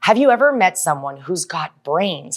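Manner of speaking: usual speaking voice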